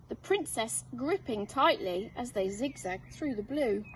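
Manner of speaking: plain reading voice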